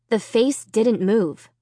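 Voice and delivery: plain reading voice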